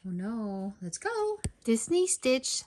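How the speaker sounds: ordinary delivery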